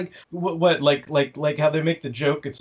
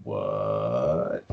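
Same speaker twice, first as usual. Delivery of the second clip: very low, long drawn what; mock stunned viewer; deadpan what; 4 dB quieter than usual. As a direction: drop the voice in flat disbelief. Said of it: "you're just like".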